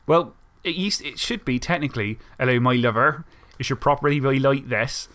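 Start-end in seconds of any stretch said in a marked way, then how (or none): 2.4-5.1 West Country-style Isle of Wight burr; Isle of Wight accent. Shown as a demonstration. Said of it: "what's the Isle of Wight accent then?".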